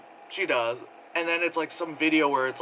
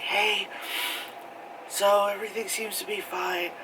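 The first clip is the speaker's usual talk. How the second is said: hollow cheer; 6 semitones higher than usual. Retold or imitated. Imitating vlogger update.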